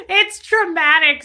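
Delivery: loud, high fake wail; mock crying. Reading Mira's chat message, melodramatic mock sobbing. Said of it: "says Mira".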